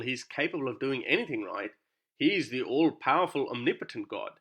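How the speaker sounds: usual speaking voice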